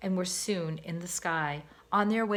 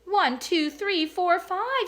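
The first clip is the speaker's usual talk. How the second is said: counting; 11 semitones higher, 6 dB louder, slower than usual. A narrator's reading. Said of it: "Sam counted".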